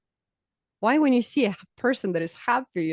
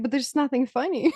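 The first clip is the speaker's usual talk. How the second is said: mom's line through laughter; 7 semitones higher, 4 dB louder than usual. Retold or imitated. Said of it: "She's like".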